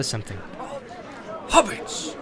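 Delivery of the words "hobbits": higher exclamation of sudden recall; sudden cry. Acted. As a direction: blurt it as memory strikes. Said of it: "he cried".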